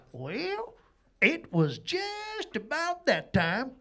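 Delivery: slow Southern narrator drawl; Dukes of Hazzard narrator. Retold or imitated. Comic mocking himself in Mississippi, Southern narrator voice. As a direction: spin it like a good-old-boy TV narrator. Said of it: "the old Dukes of Hazzard narrative voice".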